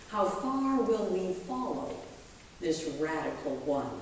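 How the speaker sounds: ordinary talk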